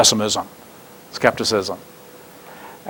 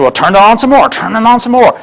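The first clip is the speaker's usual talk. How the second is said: egging on; 7 semitones higher than usual. Retold or imitated. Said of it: "And I said".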